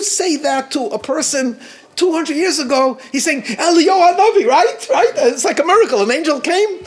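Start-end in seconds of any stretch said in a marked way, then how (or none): 3.4-6.8 exclamación asombrada, más aguda y fuerte; amazed exclamation. Retold or imitated. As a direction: como quien ve un milagro, alzando la voz. Man from long ago amazed.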